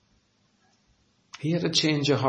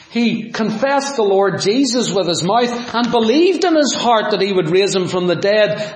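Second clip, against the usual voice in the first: ordinary delivery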